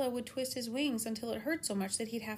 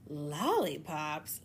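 slow crocodile voice; 4 semitones lower, much slower than usual. Acted. Crocodile savours the word.

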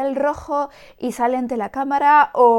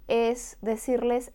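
ordinary delivery

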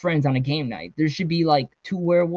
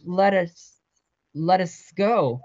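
higher, slow, broken plea; strained, stalling plea to be released; choking on words; 4 semitones higher, much slower than usual. A narrator's reading. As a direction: force it out haltingly as breath fails. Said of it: "She choked on her words".

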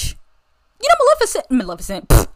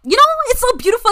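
usual speaking voice

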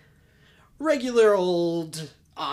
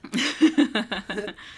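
loud high laugh bursting out; burst of laughter; 5 semitones higher, 6 dB louder than usual. Real, not acted. Laughing loudly at a friend's joke. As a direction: crack up at the joke.

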